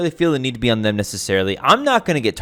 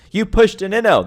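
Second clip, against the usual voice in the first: higher mocking "You pushed an inno"; mock accusation; 10 semitones higher than usual. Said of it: "they say again".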